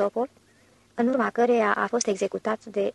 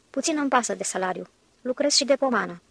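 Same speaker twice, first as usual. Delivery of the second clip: plain reading voice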